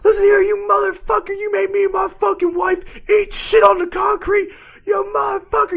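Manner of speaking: imitated ranting old biker, screeched very high; hammed-up high-pitched tirade; mock enraged screaming. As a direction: scream abuse at the people you blame. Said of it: "I think he was just angry".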